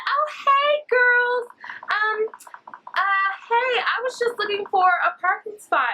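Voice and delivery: very high, sugary put-on greeting; singsong high voice of an overfriendly stranger; fake-friendly stranger. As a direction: pitch it way up, overly friendly mock woman. Acting the creepy woman greeting them.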